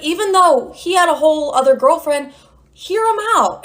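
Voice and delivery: imitated therapist. Therapist urging her to hear him out, high imitated voice. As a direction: lift the pitch and urge them along. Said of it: "She's like".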